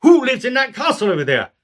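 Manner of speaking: King Arthur. Acted. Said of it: "here comes King Arthur again".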